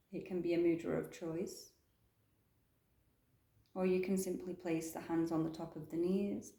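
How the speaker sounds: soft, calm meditation guidance; guided meditation. Acted. Yoga teacher guiding the class into stillness, soft meditation voice. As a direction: ease them gently into the breath.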